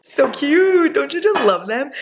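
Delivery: squealing delight. Real, not acted. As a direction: squeal over the flowers like a proud parent. Showing off her sunflowers.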